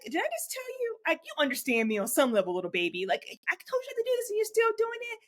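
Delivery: put-on high exasperated scolding of a baby; scolding mom. Mother scolding her little son, high and exasperated. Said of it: "I'm like that with my son".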